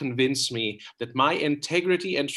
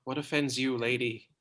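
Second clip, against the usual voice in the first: tender. Acted. Troilus soothes Cressida.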